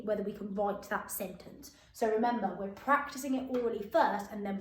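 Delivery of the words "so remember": usual speaking voice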